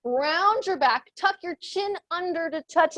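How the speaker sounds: usual speaking voice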